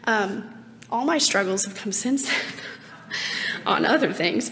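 ordinary delivery

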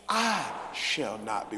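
"I" drawn out, then slow "shall not be"; stress on I. Shown as a demonstration. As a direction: lean hard and long on "I". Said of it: "put the emphasis on the first word".

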